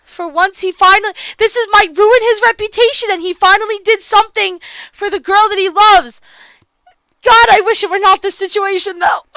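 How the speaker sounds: loud, high, fervent praise; real emotional fangirl outburst; emotional gushing. Real, not acted. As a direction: gush over the hero's sacrifice. Player moved by Jared's sacrifice.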